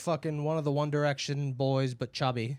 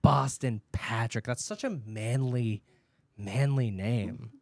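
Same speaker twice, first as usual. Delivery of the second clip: slow admiring; 5 semitones lower, slower than usual. Retold or imitated.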